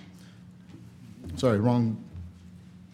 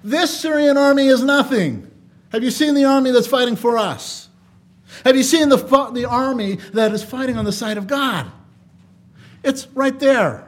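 Elisha reassuring; 6 semitones higher, 4 dB louder than usual. Retold or imitated. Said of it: "Elisha just looked at him and says".

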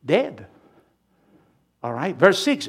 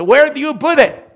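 exasperated demand; 9 semitones higher, 7 dB louder than usual. Retold or imitated. Family searching for a lost item.

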